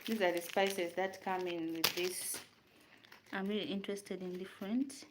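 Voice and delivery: ordinary talk, nothing marked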